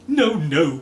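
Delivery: loud tearful protest; crying out. Acted. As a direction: wail it out. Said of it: "cried Mister Body".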